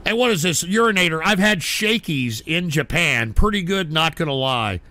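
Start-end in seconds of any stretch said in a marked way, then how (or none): none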